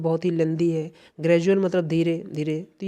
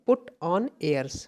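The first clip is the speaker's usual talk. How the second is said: slow dictation; much slower than usual. Shown as a demonstration. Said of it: "note down कर लीजिएगा".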